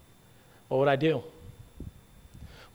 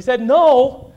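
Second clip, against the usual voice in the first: loud, slow emphasis; 6 semitones higher, 10 dB louder than usual. Real, not acted. Preacher slows with emphasis.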